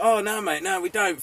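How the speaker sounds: quick eager denial. Retold or imitated. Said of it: "we were like".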